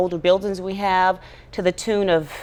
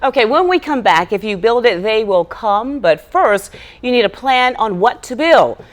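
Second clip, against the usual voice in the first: announcer teaser; 2 semitones higher, 7 dB louder than usual. Acted. Host teasing the school construction segment before the break, in announcer style. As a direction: project it as a TV host's tease.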